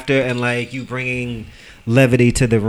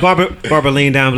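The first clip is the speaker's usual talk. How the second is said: ordinary talk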